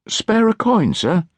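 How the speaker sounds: put-on high, thin begging child's voice; small pleading urchin voice. A narrator's reading. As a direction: beg for a coin like a starving street boy. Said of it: "he pleaded".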